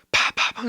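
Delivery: whispered pow pow pow; unvoiced gunshot sounds; imitated gunshots. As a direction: mimic distant gunfire under the breath.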